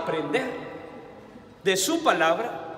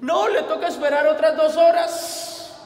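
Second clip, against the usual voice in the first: much higher mock clerk voice; rude clerk; 7 semitones higher, 4 dB louder than usual. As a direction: imitate the dismissive clerk, higher and louder. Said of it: "me dijeron". (imitando a la empleada despectiva, más aguda)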